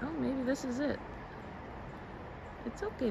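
ordinary talk

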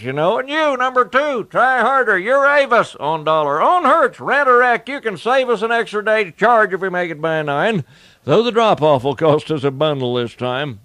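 panicked hollering down the line; shouting. Acted. Santa frantically shouts at the rental-car team to avoid a crash. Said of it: "heard someone shouting".

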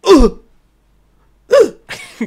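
high loud "uh, uh" like the Roblox oof; Roblox death sound. Retold or imitated. Streamer mimicking a sound from the show, high Roblox oof. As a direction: squeal it out like a game death noise. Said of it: "como el Roblox".

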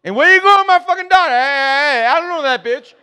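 very high, loud shouting; alarmed angry dad. Retold or imitated. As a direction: yell after a stranger taking your daughter. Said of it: "You stutter when you're mad".

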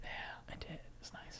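soft whispered aside. Real, not acted.

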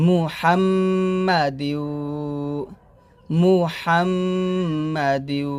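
slow মুহাম্মাদিউ with hummed m and rounded u; nasal ghunnah. Shown as a demonstration. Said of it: "গুণ আদায় করতে হবে".

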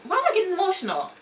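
high, fast, teary voice fighting tears; real welling up, laughing it off; getting emotional. Real, not acted. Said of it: "and I'm getting emotional".

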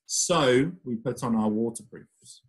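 ordinary delivery

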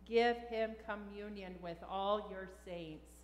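ordinary delivery

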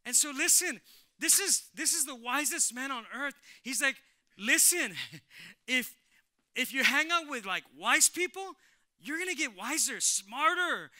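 loud, high, fired-up preaching. Real, not acted. Preacher hammering Solomon's warning to the church, full force.

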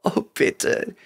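put-on whispered pleading girl; hysterical sobbing plea. A narrator's reading. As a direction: gasp it out in panic. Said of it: "ihr Schluchzen hatte einen hysterischen Unterton".

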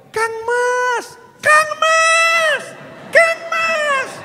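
very high sweet calling to a beloved; calling a lover. Shown as a demonstration. Preacher shows how a woman calls her beloved, very high lovesick calling. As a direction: sing out to a sweetheart, high and longing. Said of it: "kalau manggil kekasih kan lain".

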